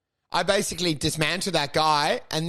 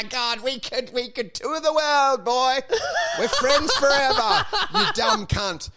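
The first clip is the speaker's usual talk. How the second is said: mock gushing buddy; 7 semitones higher, slower than usual. Mock affection to heckler.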